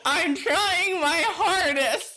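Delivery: high, whining protest through laughter; laughing protest. Real, not acted. Defending a failed accent to the co-host, laughing wail. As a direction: plead your case while cracking up.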